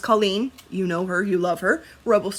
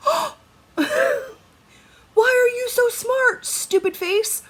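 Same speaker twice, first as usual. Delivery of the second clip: high, slow squeal of delighted disbelief; overjoyed squeal, much higher and slow; 4 dB louder, much slower than usual. Retold or imitated. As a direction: gush over a clever husband with teasing love. Retold delight at husband's idea.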